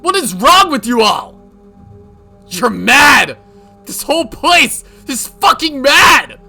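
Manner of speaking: loud, high, ranting shout; hysterical rage. Acted. Yoshimura cornered by accusations, hysterically raging. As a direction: scream it, losing all control.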